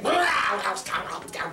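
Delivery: loud roaring bark, dropping away; big dog attack. Acted. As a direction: burst out like a savage dog attacking.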